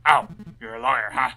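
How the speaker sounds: loud, impatient guard voice; surly guard. Acted. Guard grudgingly leads the lawyer inside, loud and impatient. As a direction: brush the lawyer off like a bored guard.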